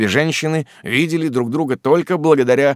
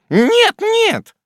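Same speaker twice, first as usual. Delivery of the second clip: high, loud curt refusal; curt snap; 6 dB louder than usual. A narrator's reading. Miss Betsey cutting off the frightened widow, high curt no. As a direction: cut her off flat. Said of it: "отрезала мисс Бетси".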